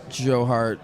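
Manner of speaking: usual speaking voice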